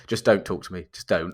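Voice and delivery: low, quiet mock refusal; imitated brush-off. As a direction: shut the caller down under your breath. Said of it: "answered the phone and either said".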